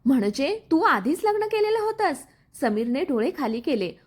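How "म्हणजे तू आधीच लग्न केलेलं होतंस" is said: higher-pitched, shaken question; trembling voice. A narrator's reading. Gauri confronts Samir about his earlier marriage, trembling voice. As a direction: ask it with a touch of a tremble, pitched up. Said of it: "गौरीचा आवाज थरथरत होता".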